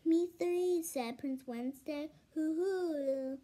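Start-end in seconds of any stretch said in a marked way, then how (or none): 0.0-0.8 excited. Acted. Prince Wednesday joins in eagerly. 2.3-3.4 owl hoot. Acted. Owl hooting.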